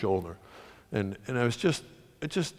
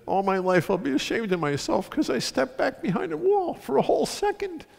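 high, strained-pitch confession in retelling; imitating shamed rescuer; 11 semitones higher than usual. Football player confessing on radio that he stepped back, ashamed. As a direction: climb steadily higher through the confession. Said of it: "and he said".

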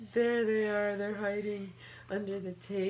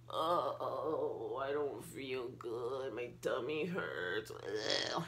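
low, slow, groaning dragon with a tummy ache; a lightly voiced sick dragon; 11 semitones lower, much slower than usual. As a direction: moan it like a poorly little dragon.

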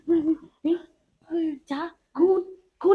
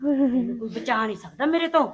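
much lower threat; menacing ghost; 8 semitones lower, 4 dB quieter than usual. Acted. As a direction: warn her darkly.

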